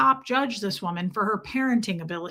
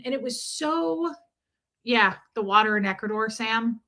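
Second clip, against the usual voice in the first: usual speaking voice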